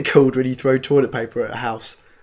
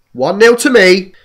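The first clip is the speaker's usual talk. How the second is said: loud, slow triumphant crow; gloating; 7 semitones higher, 10 dB louder than usual. Real, not acted. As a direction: crow over the win. Gloating over winning a point.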